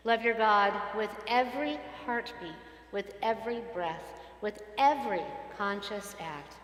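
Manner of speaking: liturgical reading. Acted. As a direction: lead the congregation through scripture. Congregational reading of the Ve'ahavta.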